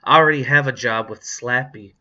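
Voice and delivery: loud, boastful one-upping; mildly snooty put-down; superior sneer. A narrator's reading. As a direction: brag with a touch of contempt. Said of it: "said with a superior sneer".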